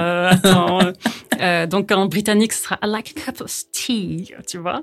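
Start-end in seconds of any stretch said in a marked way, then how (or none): 2.8-4.3 slow, careful British English accent; crisp British English, taking your time. Shown as a demonstration. A British accent shown on request to the host. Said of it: "est-ce que tu peux nous donner un petit exemple".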